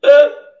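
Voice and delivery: short, loud, high laugh; sudden real laugh at your own slip; one explosive high laugh; burst of laughter. Real, not acted. Bursts out laughing after yawning.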